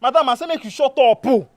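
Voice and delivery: high, loud order to shut up; exasperated loud command; angry silencing. Acted. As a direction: cut them off, voice shooting up. Cutting off the other in quarrel.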